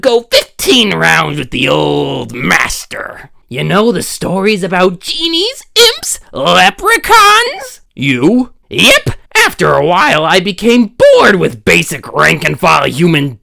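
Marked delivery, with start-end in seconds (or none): none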